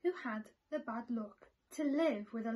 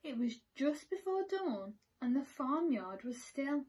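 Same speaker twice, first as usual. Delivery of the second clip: usual speaking voice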